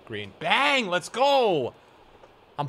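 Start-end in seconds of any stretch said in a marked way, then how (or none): 0.4-1.7 high, loud goal cheer; excited cheer. Real, not acted. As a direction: shout it with triumph. Goal scored.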